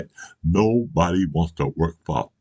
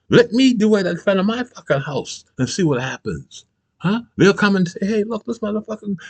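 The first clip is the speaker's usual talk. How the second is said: heated rant; 3 dB louder than usual. Real, not acted. Ranting about the flag.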